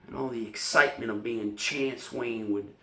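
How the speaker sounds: subdued, brooding confession with brief flares; troubled serviceman remembering his fears; haunted fading golden boy. Acted. Performs Sweet Bird of Youth monologue.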